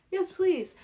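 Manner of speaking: excited yes please. Retold or imitated. Hearing good things about the books, thrilled yes please. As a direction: jump at it, thrilled. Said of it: "and I'm like".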